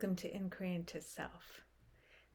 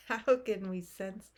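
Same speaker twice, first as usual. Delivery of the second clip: amused; 9 dB louder than usual. Real, not acted. Laughs amid the meditation.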